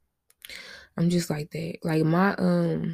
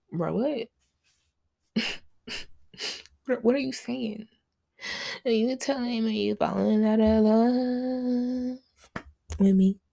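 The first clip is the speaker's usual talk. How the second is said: mock disbelief; 5 semitones higher, slower than usual. Mock confrontation of the ex.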